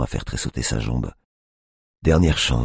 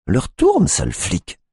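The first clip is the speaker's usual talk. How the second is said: insult; 12 semitones higher, slower than usual. A narrator's reading. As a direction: sneer it at the cop. Tiffany insults cop.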